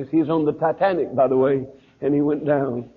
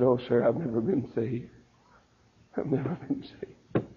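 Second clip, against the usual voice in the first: quiet confession; 4 semitones lower, 9 dB quieter than usual. Retold or imitated. Preacher's wife admitting to the evangelist, hushed. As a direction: let it fall away quietly.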